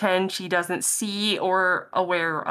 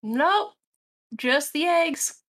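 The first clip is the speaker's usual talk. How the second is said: higher, slow Southern drawl; Sally in a light Southern accent; 8 semitones higher, much slower than usual. Acted. Sally answering the merchant in a light Southern accent. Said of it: "I don't know what happened to Southern accent there".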